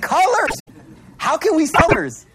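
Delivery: high, excited disbelief; amazed friend. Retold or imitated. Friend marvelling to the speaker about seeing colors, high and excited. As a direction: marvel at the wonder of it. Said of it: "He's like".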